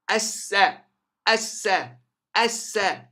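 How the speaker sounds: ASSA with a long, strong double s; doubled seen. Shown as a demonstration. Teacher drops the lam in seen, doubled s. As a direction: lean hard on the s in ASSA. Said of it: "then you add shadda to".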